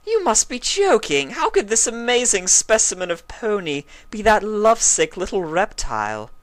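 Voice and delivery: high, loud mocking scoff; dismissive scoff. A narrator's reading. Rarity laughing off the idea, scornful. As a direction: brush it off with scorn. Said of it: "Rarity laughed".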